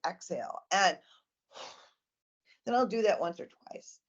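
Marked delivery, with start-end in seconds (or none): 0.1-0.6 a soft, low "Exhale."; soft exhale cue. Shown as a demonstration. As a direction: breathe the word out gently. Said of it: "And then I'll say".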